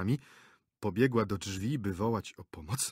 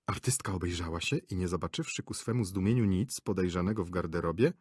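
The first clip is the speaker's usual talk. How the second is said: ordinary delivery, nothing marked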